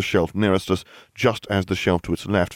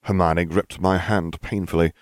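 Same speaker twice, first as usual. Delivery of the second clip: plain reading voice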